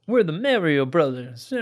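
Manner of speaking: put-on slow, drawn-out Brooklyn accent. Host mimicking the old Super Mario Brothers commercials, Brooklyn accent. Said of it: "they did have a Brooklyn accent".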